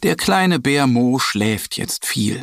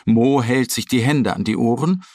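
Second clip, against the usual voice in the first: plain reading voice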